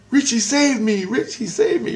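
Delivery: high, pleading little voice; voice of innocence. Retold or imitated. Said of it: "all I hear is my innocence say".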